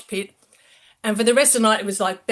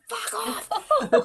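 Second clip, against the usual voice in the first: high, laughing mock dismissal; giggly retold brush-off; laughing fuck off; 13 semitones higher than usual. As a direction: laugh while brushing him off again.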